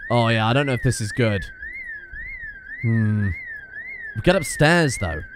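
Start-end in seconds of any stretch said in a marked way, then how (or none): none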